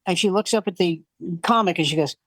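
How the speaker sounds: ordinary talk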